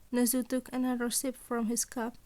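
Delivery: ordinary delivery, nothing marked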